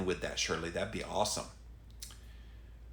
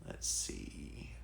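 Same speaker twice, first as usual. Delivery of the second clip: much softer, whispered to himself; whispered aside; 14 semitones lower, 14 dB quieter than usual. Real, not acted. Host scrolling comments, muttering to himself in a whisper. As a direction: whisper it while looking.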